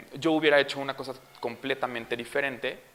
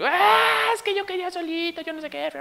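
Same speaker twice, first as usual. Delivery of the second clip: gritos de berrinche; 4 dB louder than usual. Retold or imitated. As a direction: imitate a screaming toddler insisting. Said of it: "tus gritos". (chillido agudo imitando un berrinche)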